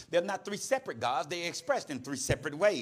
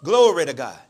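loud, lower-pitched echo of praise; repeating aloud; 4 semitones lower, 12 dB louder than usual. Retold or imitated. Woman repeats the quiet words aloud.

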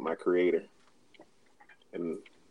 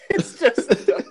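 laughing; 10 dB louder, slower than usual. Real, not acted. Laughing at own story.

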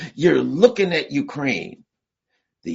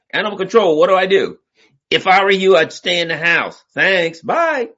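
acted phone call; 7 semitones higher, 6 dB louder than usual. Phoning animal control.

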